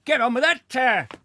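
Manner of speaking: high, loud barked order; clown urging. Acted. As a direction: hurry him along, shouting.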